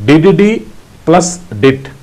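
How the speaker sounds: Didi plus dit spoken slowly and evenly. Shown as a demonstration. Morse teacher showing the letter H slowly. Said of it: "pronounce like".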